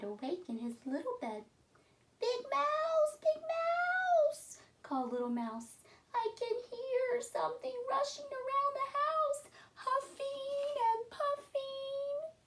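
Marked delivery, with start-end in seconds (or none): 2.2-4.6 high squeaky little mouse voice calling out. Acted. 6.1-12.3 high squeaky little mouse voice calling out. Acted.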